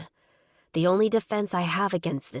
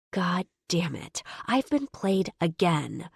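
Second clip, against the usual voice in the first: ordinary delivery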